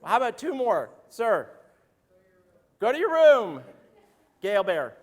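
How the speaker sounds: normal talking voice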